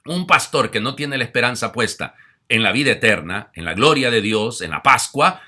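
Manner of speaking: loud, high, fervent preaching; forceful preaching. Acted. Priest preaching loudly to his flock. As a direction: preach it out to the congregation.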